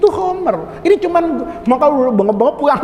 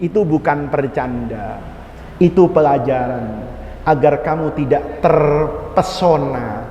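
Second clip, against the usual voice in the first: solemn, slow teaching; 10 semitones lower, slower than usual. Acted. Teacher explains the lesson solemnly.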